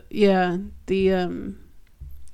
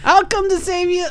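bad Willie impression; 10 semitones higher than usual. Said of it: "I'm really bad at this".

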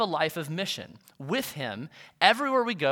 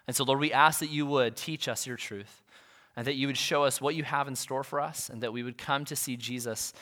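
low, soft praying voice; reverent prayer; 6 semitones lower, 5 dB quieter than usual. Real, not acted. Pastor praying with congregation before opening God's word, reverent prayer. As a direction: address God quietly, in a lowered voice.

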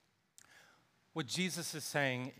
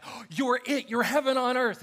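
higher and louder, gushing with delight; naive excitement; 10 semitones higher, 9 dB louder than usual. Retold or imitated. Nathanael finding his heaven on earth, high gushing delight. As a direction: grab hold of it like a starstruck fan. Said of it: "he's immediately like".

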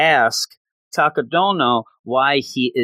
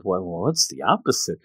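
pondering; 6 semitones lower, 4 dB quieter than usual. Retold or imitated. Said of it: "he's trying to think of".

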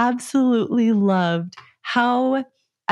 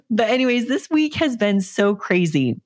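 ordinary talk, nothing marked

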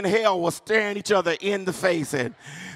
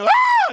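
sudden loud, very high scream; hammed-up mourner's shriek; mock funeral scream; 7 dB louder than usual. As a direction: let out a piercing holler. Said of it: "just scream".

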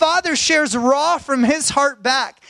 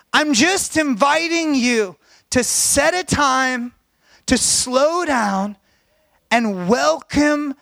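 slow; 3 semitones higher, slower than usual. Acted. Inviting listeners to slow down.

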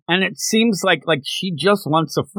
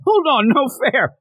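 whiny protest; 12 semitones higher than usual. Retold or imitated. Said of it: "she goes".

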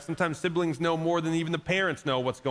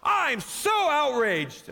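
slow, higher, hammed-up fake outrage; mock outrage; 8 semitones higher, much slower than usual. Passive church reacting, slow mock outrage. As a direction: drawl the hollow outrage. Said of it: "such a passive response".

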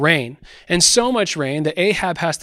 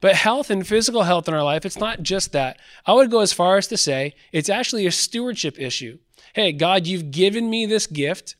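usual speaking voice

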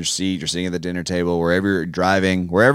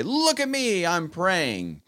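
show-off voice; 7 semitones higher, 3 dB quieter, slower than usual. Retold or imitated. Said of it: "people who would stand on the street corners and say".

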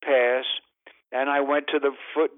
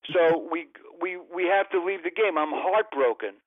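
ordinary talk, nothing marked

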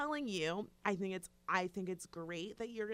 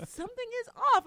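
mock insistence; 13 semitones higher, 7 dB louder, much faster than usual. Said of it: "And I'm like".

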